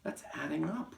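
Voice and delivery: high-pitched protesting schoolboy; anxious cry. Acted. Wilfred answering the Trunchbull, anxious cry. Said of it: "Wilfred cried".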